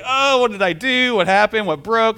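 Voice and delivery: fretting aloud, voice up and strained; panicked worry. Retold or imitated. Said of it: "you're just like".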